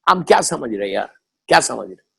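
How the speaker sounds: low, careless mock of a son brushing off his mother; mocking a rude son. Preacher mimics a son sitting while his old mother stands, low and dismissive. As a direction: drop the pitch and sound offhand and dismissive. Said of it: "ماں سے ایسے بات کرتے ہیں".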